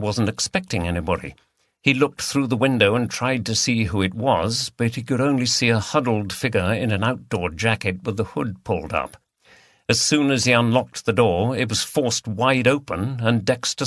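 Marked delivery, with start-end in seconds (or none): none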